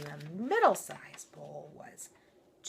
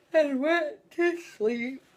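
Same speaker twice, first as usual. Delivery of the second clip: sleepy voice; 10 semitones higher, 8 dB louder, slower than usual. A narrator's reading. Sleepy lullaby ending.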